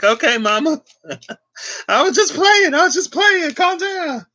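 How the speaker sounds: high-pitched squeaky laughing, calming mom down; laughing placating. Retold or imitated. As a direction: giggle it out in a very high voice, pleading. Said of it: "I'm like".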